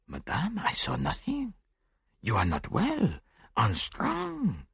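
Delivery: calm her down softly, pitch lilting up; soothing. A narrator's reading. Detective tells the hysterical widow she is unwell, soothing.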